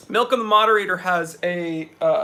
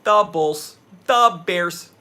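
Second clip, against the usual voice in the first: put-on loud Chicago sports fan accent; 7 dB louder, much slower than usual. Chicago accent shouted at the chat, loud. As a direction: bark the team names like a Chicago fan. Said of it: "Midwestern accent".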